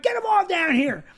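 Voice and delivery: high-pitched mock challenge; retold defiant yell. Comic defies a bartender saying calm down, retold defiant yell. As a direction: throw the dare back, voice jumping up.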